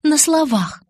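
high, loud grumbled retort; grumbling. A narrator's reading. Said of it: "проворчала она".

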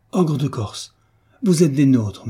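loud, high triumphant cry; triumphant exclaim. A narrator's reading. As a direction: crow it out in triumph. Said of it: "s'écria la ménagère triomphante".

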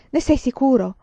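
ordinary delivery

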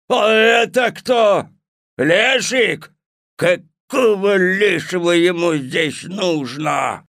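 loud, slow grumpy bellow; gruff voice. Acted. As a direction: grumble loudly like an old ogre. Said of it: "Голос у Водяного такой грубый".

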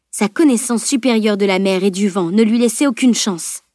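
ordinary delivery

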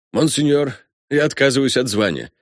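formal colonel renouncing his rank; crisp, formal declaration. A narrator's reading. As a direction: state it firmly, like an officer reporting. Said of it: "отчеканил Фогдахе".

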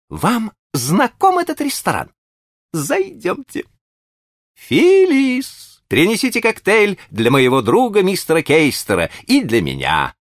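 high, bright eager host voice; cheerful high gentleman. A narrator's reading. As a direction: gush over an old friend, high. Green orders in restaurant.

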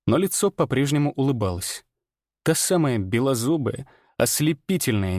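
plain reading voice

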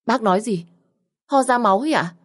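stunned, frightened question; shocked disbelief. Acted. Daughter shocked by blood news.

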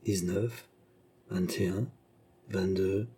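hushed, soft count to oneself; breathless-with-joy counting. A narrator's reading. Beausire gathers the gold coins, counting in hushed joy. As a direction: count softly, absorbed in the treasure. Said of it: "pantelant de joie".

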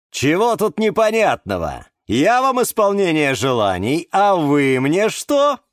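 puffed-up flower wizard laying down rules; pompous wizard. A narrator's reading. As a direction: play the grand hyacinth magician. Eduard bargaining.

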